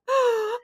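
a sharp, high staged gasp; hammed-up gasp of dismay; high mock gasp. Mother retells her shock.

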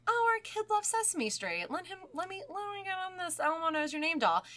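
high, mock cheerful parent voice; a light chirpy put-on parent; imitating the parents. Parents excited to buy the doll, mock cheerful high voice. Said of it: "this family is like".

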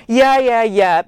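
tipsy Ben cutting Martin off, loud and dismissive; dismissive yeah yeah. Acted. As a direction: wave him away with your voice. Drunk Ben brushing Martin off.

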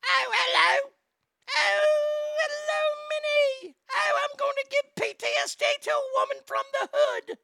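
very high, far, shrill-voiced British lady impression; mocking Sharon Osbourne voice, very high; falsetto Sharon. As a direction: squeal it like a tiny posh woman.

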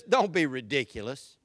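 low, dismissive doubting voice; dismissive naysayer. Acted. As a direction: drop low and discourage them. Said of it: "The other voice is".

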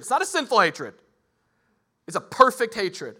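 loud, high, driving sermon voice; impassioned pulpit delivery; preaching at full force. Acted. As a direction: preach it with fire.

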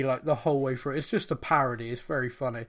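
normal talking voice

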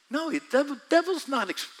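ordinary talk

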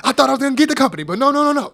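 high, loud, rapid-fire wrestler impression; Shane O'Mac impression. Showing off an old impression to the co-host, hammed-up. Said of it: "Shane O'Mac".

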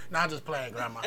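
ordinary talk

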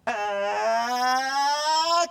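long mock wail rising in pitch; imitated child's cry. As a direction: imitate a nursery child bursting into tears. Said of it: "child starts to cry".

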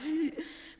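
mock gasp. Walking into the room.

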